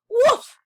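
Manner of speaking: a yippy puppy bark, high and loud; high excited woof. Acted. Puppy barking after a robin, high yippy woof.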